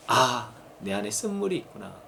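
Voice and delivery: acted soft, low realization; quiet realization. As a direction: murmur it as if just discovering it inwardly. Acts out a quiet self-realization.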